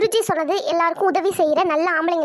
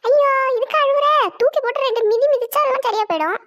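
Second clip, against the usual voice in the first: put-on high, scoffing old granny; old woman voice; 7 semitones higher than usual. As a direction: brush off the tears as an old lady. Grandmother dismisses the crying.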